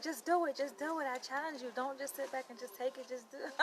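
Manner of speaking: lightly voiced professor pushing her to do it; imitating professor.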